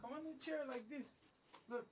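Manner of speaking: high, hushed coaxing to a pet; talking to a dog. Real, not acted. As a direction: softly urge the dog up. Coaxing dog onto the chair.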